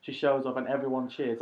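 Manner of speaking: normal talking voice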